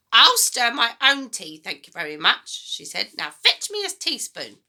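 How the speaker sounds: put-on high, loud, bossy old woman; a mean grandma giving orders, high and loud; grumpy grandma voice. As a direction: nag the boy like a sour old granny. Grandma snaps.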